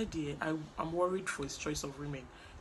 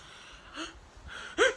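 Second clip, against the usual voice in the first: shocked gasp. Retold or imitated. Shocked retelling of the fight.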